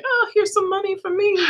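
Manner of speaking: Kim Kranz cashing in. Retold or imitated. Mocking the deck creator cashing in, high singsong. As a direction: sneer it in a high cartoonish voice. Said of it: "Kim Kranz is definitely like".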